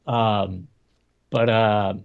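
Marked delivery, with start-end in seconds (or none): none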